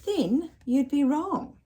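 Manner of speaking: little voice. Acted. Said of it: "said a little voice".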